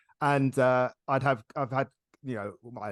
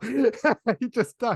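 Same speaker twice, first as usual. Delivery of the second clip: laughing through it, slow and louder; 10 semitones higher, 6 dB louder, much slower than usual. Real, not acted. Host laughing at men who lecture on menopause, slow laughing insistence.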